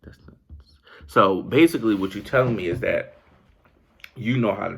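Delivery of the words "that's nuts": breathy whispered disbelief; a hushed, stunned whisper. Real, not acted. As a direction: barely voice it, stunned. Stunned aside after the quote.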